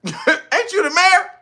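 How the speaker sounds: high, loud mock disbelief; high mocking "ain't you the governor"; incredulous questioner. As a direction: squeak it in disbelief. Mock questioner confronting mayor.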